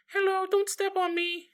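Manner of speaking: tiny, very high creature voice; little voice. Acted. Tiny mushroom pleading with visitors underfoot, little high voice. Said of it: "we hear this little voice go".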